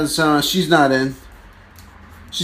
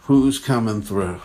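usual speaking voice

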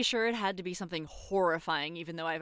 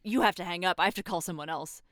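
ordinary talk

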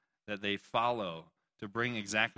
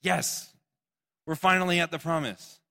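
higher, louder happy cheer; mock excitement; 6 semitones higher, 6 dB louder than usual. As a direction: cheer as if arriving at last. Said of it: "and it's like".

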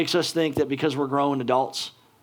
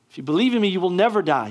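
higher and louder, ringing out the promise; preaching at full force; 5 semitones higher, 3 dB louder, faster than usual. Acted. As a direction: proclaim it to the whole hall, building. Preacher repeats promise with rising force.

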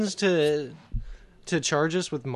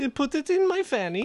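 put-on high-pitched silly foreign accent; [name]'s bad accent; 11 semitones higher than usual. Co-host piling on the guest, silly high foreign accent. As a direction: pipe it up in a mock foreign accent. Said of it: "What the fuck is that accent?".

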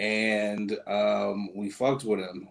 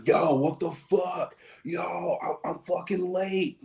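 higher, frantic swearing; panicked friend; 7 semitones higher than usual. Retold or imitated. Buddy finds his car wrapped, mildly panicked. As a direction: sound late and freaking out. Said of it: "He was like".

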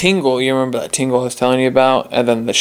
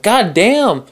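retold pained shock; 7 semitones higher than usual. Retells reaction to being hurt.

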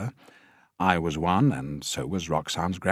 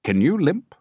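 much higher, louder director; 11 semitones higher, 8 dB louder than usual. A narrator's reading. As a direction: ask it from the top of the voice. Director asks Tiny.

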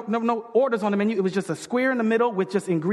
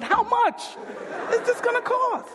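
higher, slower comic squeal; squeaky incredulous; 13 semitones higher than usual. Retold or imitated. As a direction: let the voice fly up in mock panic over the bill. Asks wife after divine bite.

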